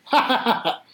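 high, staccato ha-ha laugh; mock laugh. Viewer reacting to the first fall, mock laughing. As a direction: imitate a viewer's quick laugh.

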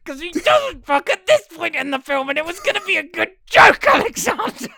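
high, loud, half-laughing protest; complaining to his co-host about the spoiled joke, high and loud; exasperated high complaint. Real, not acted. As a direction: whine that the joke is ruined.

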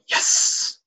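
whispered "Yes" with a drawn-out S; long hissed S. Retold or imitated. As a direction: hiss "Yes" like a snake. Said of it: "the S was so long".

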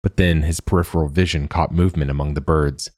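ordinary delivery, nothing marked

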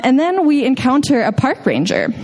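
ordinary delivery

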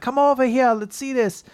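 put-on high, pushy European-accented saleswoman; thick European accent. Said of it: "very thick European accent".

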